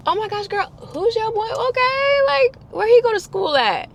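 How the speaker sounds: high, loud gushing; gushing girl talk. Retold or imitated. Quizzing her roommate about the boyfriend, high excited gushing. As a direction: squeal it like juicy gossip.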